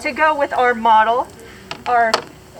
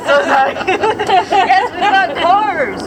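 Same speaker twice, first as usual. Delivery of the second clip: talking through laughter, higher and louder; laughing; 6 semitones higher, 6 dB louder than usual. Real, not acted. Teacher laughing at the student's accent, laughing. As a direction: laugh it out as you agree.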